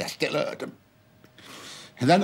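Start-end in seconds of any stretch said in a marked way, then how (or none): 0.0-0.7 dropped much softer, nearly to himself; soft, hurt murmur; quiet. Real, not acted. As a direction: let it sink to a hush. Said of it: "Even when they said it quietly".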